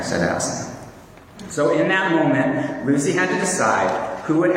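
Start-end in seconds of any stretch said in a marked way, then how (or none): none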